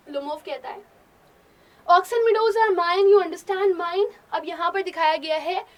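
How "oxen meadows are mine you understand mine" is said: loud, slow, higher insistence; angry shout. Acted. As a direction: hammer the claim at her. Lomov shouts his claim.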